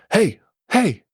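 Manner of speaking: high shout from a distance; high-pitched calling hey. Acted. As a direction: call out to them from down the hill. NPC calling out from hill.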